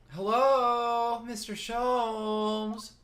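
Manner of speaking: high, very slow, drawn-out greeting; high heroine voice. Acted. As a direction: sing out the greeting in a high young woman's voice. Voicing the heroine greeting Sholmes.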